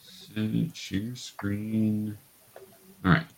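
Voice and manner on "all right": normal talking voice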